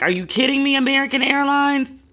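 high, incredulous mock outrage; squeaky mock indignation at a cancelled flight; exasperated disbelief. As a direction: throw it at the airline in disbelief. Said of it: "And I was like".

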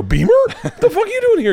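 high-pitched, laughing disbelief; astonished greeting. Retold or imitated. Teller replays running into his schoolmate, high astonished outburst. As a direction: act out amazed recognition of a friend. Acted surprise meeting old schoolmate.

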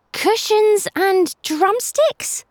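put-on a kid who can't make sense of it; young boy lost in confusion; puzzled boy. A narrator's reading. Obi puzzled by dog's clues.

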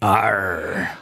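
mock pirate arr; pirate growl. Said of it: "talk like a pirate day".

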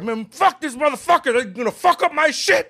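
high, loud reenacted outrage; livid rant. Fan reliving his first day after the casting news, livid. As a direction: rage like a fan betrayed by the casting. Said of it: "I was livid".

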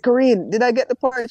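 bursting to know if he got it; eager excitement. Retold or imitated. Eager to hear about role.

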